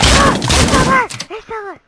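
very high, loud cry of alarm; shrieked alarm, easing on the repeat; panicked scream. Real, not acted. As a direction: scream a warning mid-fight. Surprised by enemy still there.

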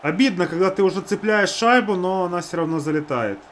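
ordinary talk

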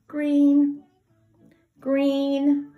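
high drawn-out green; singsong sign word. Shown as a demonstration. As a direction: sing it out for kids. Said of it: "you go side to side like this".

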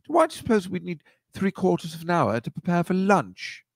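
high-pitched mock British kid; hammed-up prim English accent. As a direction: imitate a proper little British boy. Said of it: "these two British guys".

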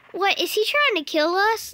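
high, loud mock alarm. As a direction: yelp it in play panic.

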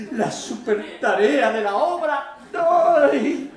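high, loud, drawn-out exaltation; rapturous outburst. Acted. Actor listing method terms to the audience, rapturous. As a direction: build slowly into an ecstatic loud cry.

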